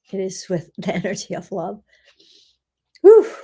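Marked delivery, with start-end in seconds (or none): 3.0-3.3 loud high exhale of release; high whew. Real, not acted. Healer releasing energy after the love prayer, loud high whew. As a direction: let the energy go in a burst.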